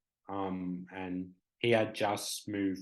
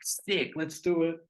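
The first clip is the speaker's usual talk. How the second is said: high, loud burst of excitement; eager agreement; 8 semitones higher, 5 dB louder than usual. Retold or imitated. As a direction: pounce on the plan, pitched well up. Speaker reacts eagerly to the yes.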